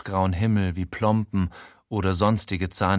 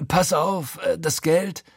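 higher pleading voice of a pushy con man; wheedle, pitched up; Frankie's voice; 7 semitones higher, slower than usual. A narrator's reading. Frankie leaning in on his friend, pleading.

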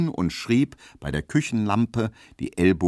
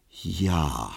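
a drawn-out, pondering yes; slow and thoughtful; 5 semitones lower, 4 dB quieter than usual. A narrator's reading. Frau Markowski after a long pause, slow and thoughtful. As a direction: let the word hang, thinking it over. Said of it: "sehr langsam und nachdenklich".

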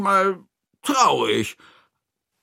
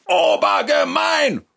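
high, drawn-out roar; bellowing; 4 dB louder than usual. Acted. Narrator tops Toni's yell, a long high roar. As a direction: bellow it, stretching the word. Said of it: "brülle ich".

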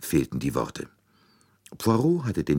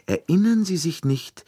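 excited reminder; 5 dB louder than usual. A narrator's reading. Poirot excitedly reminds Bouc.

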